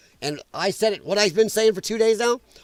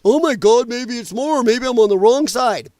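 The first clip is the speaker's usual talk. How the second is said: higher, louder fake fretting; hammed-up worried voter doubting himself; mock panic; 4 semitones higher, 4 dB louder than usual. Host mocks wavering voters, hammed-up alarmed whine. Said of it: "hoping to make everybody think".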